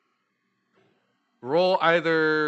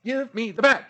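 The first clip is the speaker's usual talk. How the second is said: demanding elf; 10 semitones higher, 4 dB louder than usual. Acted. Elf demanding bag on rooftops.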